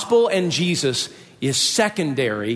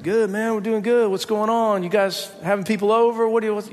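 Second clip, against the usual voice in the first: higher-pitched retold banter; raised, casual guy-to-guy chatter; imitating cool buddies; 7 semitones higher than usual. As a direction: rattle off greetings as the athletes. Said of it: "They said".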